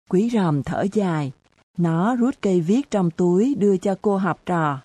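ordinary delivery, nothing marked

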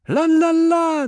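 loud, very high wailed la-la; women's cry. A narrator's reading. Hera echoing the women as raiders came, high cry. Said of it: "the women cried".

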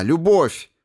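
high and loud, lifting the head; exultant cry. Acted. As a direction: cry it out again with conviction. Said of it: "вдруг вскинула голову".

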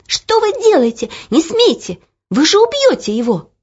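put-on high, loud, horrified girl's cry; girl's shout. A narrator's reading. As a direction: shout to stop the whipping. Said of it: "крикнула". (высокий испуганный крик девочки)

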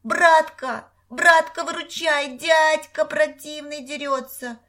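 put-on high, loud, childish wail; дурашливый вопль. A narrator's reading. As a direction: yell for big brother like a simpleton. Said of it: "засучил ногами и завопил". (истошно вопя высоким голосом)

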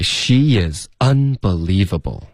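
"she is" linked, "unbelievable" slow with clear stresses; slow model line. Shown as a demonstration. Teacher confirming the co-host's attempt, slow model. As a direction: stretch "she is unbelievable" for learners. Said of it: "就是这么说的".

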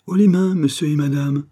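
loud, high command at gunpoint; cry out. Acted. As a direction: shout them down. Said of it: "s'écria".

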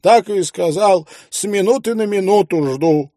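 high, breathless panicked pleading; hysterical wife. A narrator's reading. Wife in hysterics urges husband to leave, high panicked. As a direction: beg him to flee at once. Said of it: "бьётся в истерике".

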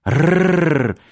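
high, rolled mock growl; playful growl. A narrator's reading. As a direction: roll the r like a play-fighting cub. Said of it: "шутливо зарычала Лера". (шутливое раскатистое рычание)

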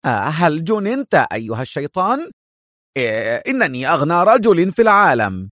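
angry cry. Acted. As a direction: exclaim in offended anger. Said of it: "صاح ألان في غضب".

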